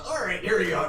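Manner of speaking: loud. Acted. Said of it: "pulls out a ballista".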